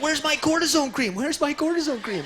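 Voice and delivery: high-pitched mock panic; freaking out. Drunk uncle frantically demands his cream at a police stop, acted freak-out. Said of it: "he's freaking out".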